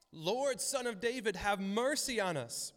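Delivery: high, drawn-out cry for mercy; retold shout. Blind men by the road calling to Jesus, retold shout. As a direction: imitate shouting out to someone passing. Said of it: "they started to shout".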